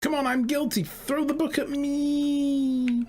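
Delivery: high, pleading cry of a man begging to be convicted; eager confessing defendant. Acted. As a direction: beg the judge, voice way up. Voicing eager defendant Ron.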